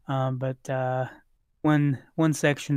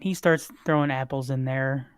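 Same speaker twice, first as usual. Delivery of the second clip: ordinary talk, nothing marked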